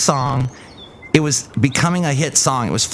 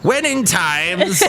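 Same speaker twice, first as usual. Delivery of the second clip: slow, raised pompous delivery; mock oratory; 5 semitones higher, much slower than usual. Host parodying the co-host's closing speech, mock-grand. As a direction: start a grand speech in jest.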